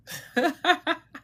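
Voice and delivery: short high laugh. Real, not acted. Vlogger laughing after quoting a mom. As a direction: chuckle at the joke.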